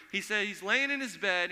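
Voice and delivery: usual speaking voice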